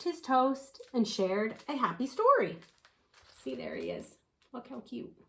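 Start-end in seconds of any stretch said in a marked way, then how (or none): 3.3-5.2 much softer, off to the side; soft aside. Real, not acted. Reader points out the owl to the child, very soft.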